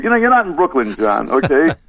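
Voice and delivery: high-pitched retold retort, amused; mock fed-up comeback, a laugh creeping in; mock exasperation. Retelling his reply to a complaining colleague, mock exasperation.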